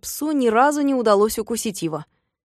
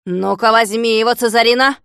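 louder call setting the dog on; loud urging; 2 semitones higher, 6 dB louder than usual. Acted. Klops setting his second dog on, loud urging. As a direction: urge the dog on hard.

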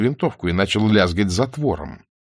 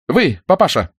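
high, sharp call; single him out with authority; commanding address; 8 semitones higher, 4 dB louder than usual. A narrator's reading. Armed Nina commands older soldier. (властный оклик)

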